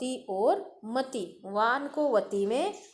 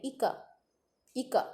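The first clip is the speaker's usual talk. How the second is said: usual speaking voice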